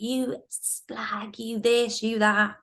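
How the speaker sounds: mock jealous telling-off, without shouting; crazy boyfriend. Colleague posing as her boyfriend berates her, crazy boyfriend. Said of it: "he was my crazy boyfriend".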